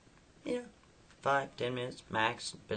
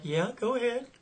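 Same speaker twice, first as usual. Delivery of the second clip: sceptical; 12 semitones higher, 4 dB louder than usual. Retold or imitated. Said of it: "She's like".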